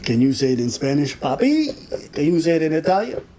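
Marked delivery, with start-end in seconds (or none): 1.2-2.1 teasing papi. Pretend. Mock Spanish daddy call.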